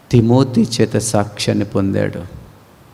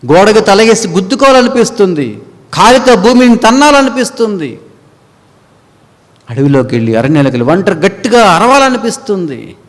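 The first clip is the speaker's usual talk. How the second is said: higher, louder preaching; full-force preaching; 8 semitones higher, 6 dB louder than usual. Acted. Preacher describing the anguish of that day, loud preaching. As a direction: drive the despair home.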